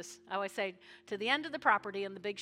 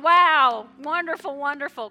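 shouted, higher cry of wonder; pastor's joy; 5 semitones higher, 18 dB louder than usual. Retold or imitated. Pastor shouting his own joy over the returning, louder and higher. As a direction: let it burst out with delight. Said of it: "I'm going".

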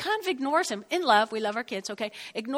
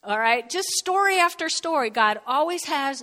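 ordinary talk

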